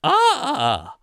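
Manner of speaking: high child's mock shriek; child's scream. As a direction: shriek like a startled kid. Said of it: "a poor child just going".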